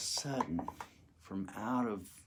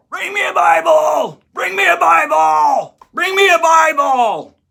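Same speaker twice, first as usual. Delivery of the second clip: much louder and much higher, a desperate yell; 15 dB louder than usual. Retold or imitated. Prisoner yelling at the guards, much louder and much higher. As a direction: bellow for the guards. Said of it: "started yelling out for the guards".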